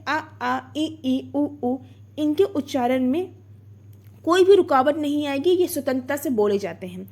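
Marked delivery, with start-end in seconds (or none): 0.1-1.8 आ, ई, उ each sounded alone as pure vowels; vowel demonstration. Shown as a demonstration. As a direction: say आ, ई, उ as separate, unobstructed vowel sounds. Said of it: "कोई भी स्वर का उच्चारण आप करके देखिए".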